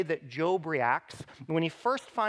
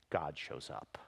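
hushed reveal; 14 semitones lower, 11 dB quieter than usual. Acted. Dramatic reveal, repeated line.

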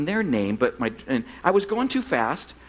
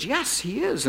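higher-pitched quick wifely interjection; Brenda; 6 semitones higher, slower than usual. Retold or imitated. Wife vouching for husband to the cop, higher-pitched imitation. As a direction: lightly imitate a wife backing up her husband. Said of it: "my wife was right there. You know, Brenda goes".

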